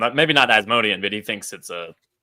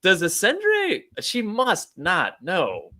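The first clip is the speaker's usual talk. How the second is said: slow puzzled; 4 semitones higher than usual. Retold or imitated. Own thought, very slow.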